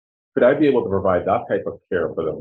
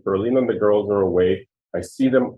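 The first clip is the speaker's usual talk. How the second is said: normal talking voice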